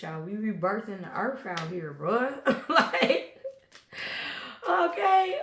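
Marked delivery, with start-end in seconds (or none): 2.4-5.4 laughing, high and loud okay. Real, not acted. Reader amused at her own line, laughing high. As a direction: crack up and squeak it out.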